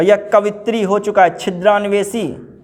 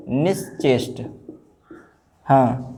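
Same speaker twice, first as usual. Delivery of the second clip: normal talking voice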